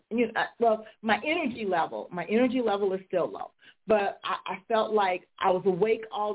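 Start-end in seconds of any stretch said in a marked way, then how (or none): none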